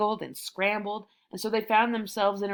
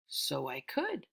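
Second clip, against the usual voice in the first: lightly voiced tiger; 7 semitones lower, 9 dB quieter, slower than usual. A narrator's reading. Tiger muses on idea.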